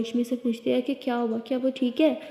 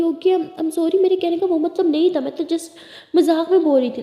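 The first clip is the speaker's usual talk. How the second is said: higher and louder, anxious sorry; pleading apology; 5 semitones higher, 6 dB louder than usual. Acted. Lily apologizes to Lakshmi, higher and pleading. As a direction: beg her not to be angry.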